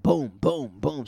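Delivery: loud, punched-out sound effects; punchy booms. Retold or imitated. Telling how his friend got back into it, punchy. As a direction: punch each boom out hard and loud. Punctuating her comeback on stage.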